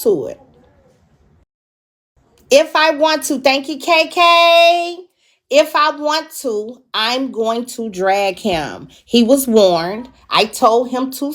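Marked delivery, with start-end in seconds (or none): 2.5-8.4 defiant emphasis. Real, not acted. Defiant vow, thanking a viewer.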